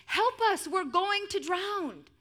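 high, loud, drawn-out cry for help; panicked storybook shout. A narrator's reading. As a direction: shout desperately over a storm. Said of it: "they shouted".

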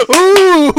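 loud, high "ooh" through laughter; delighted whoop. Real, not acted. Co-host reacts to the Wisconsin impression, laughing whoop.

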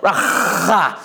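"ra" with a long, phlegmy throat H; hammed-up guttural Hebrew H after "ra"; spitting H. Shown as a demonstration. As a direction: scrape the end of "ra" as if about to spit. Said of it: "make sure you pronounce that".

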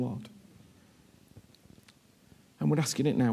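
soft, low earnest prayer voice. Acted. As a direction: pray for the church, gently and low. Pastor leads congregation in prayer.

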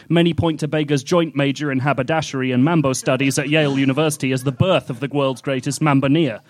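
ordinary delivery

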